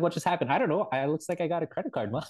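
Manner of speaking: high-pitched, hurried confusion; retold bewilderment. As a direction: replay being baffled in front of Mom. Retold bewildered exchange with mom.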